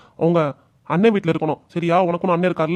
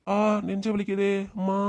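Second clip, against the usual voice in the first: chest pain; 3 semitones higher, 5 dB quieter, slower than usual. Acted. Sudden chest pain mid-argument.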